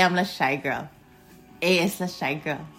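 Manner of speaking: usual speaking voice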